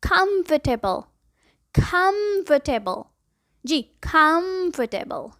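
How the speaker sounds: कंफर्टेबल as kumf-ta-bul, slowly; slow correct pronunciation. Shown as a demonstration. Teacher models the right comfortable. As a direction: say कंफर्टेबल in three beats, slowly. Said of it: "सही pronunciation है".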